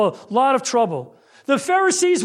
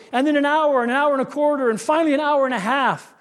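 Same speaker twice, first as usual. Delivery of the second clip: ordinary delivery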